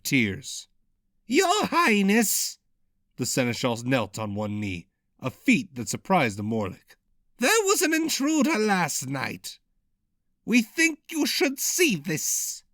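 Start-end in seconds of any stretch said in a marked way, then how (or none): 1.3-2.6 high whiny servant on verge of tears; much higher, whining plea; whiny cracked voice. A narrator's reading. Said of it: "had a whiny, cracked voice". 7.4-12.6 high whiny servant voice, slowing; nervous servant whining high; whiny cracked voice. A narrator's reading. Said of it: "had a whiny, cracked voice".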